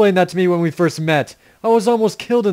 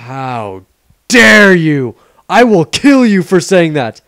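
mock outrage; 7 dB louder, slower than usual.

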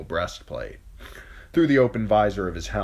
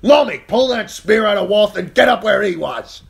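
loud, high battle commands; shouting orders; 13 dB louder than usual. Acted. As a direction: bark orders over the fight.

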